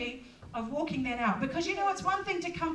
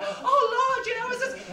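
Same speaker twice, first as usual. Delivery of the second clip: exasperated cry; 9 semitones higher, 5 dB louder than usual. Retold or imitated. Retelling anxious prayer, voice breaking high.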